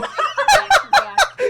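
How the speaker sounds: very high shrieking laughter; helpless high-pitched cackling; screaming laugh. Real, not acted. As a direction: laugh till it turns into a scream. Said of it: "That scream".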